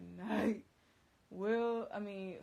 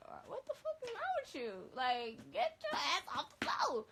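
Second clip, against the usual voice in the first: high, loud disbelief, far above usual; stunned yell at a kneeling friend; incredulous outburst; 9 semitones higher, 4 dB louder than usual. Retold or imitated. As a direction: get your ass off the floor, high and incredulous. Telling kneeling guy to get up.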